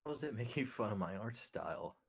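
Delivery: ordinary talk, nothing marked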